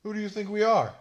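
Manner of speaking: loud offended yell; indignant shout. Acted. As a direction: shout it, insulted. Offended outburst.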